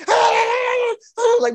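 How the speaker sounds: high laughing shout. Real, not acted. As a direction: laugh through the words. Laughing while telling.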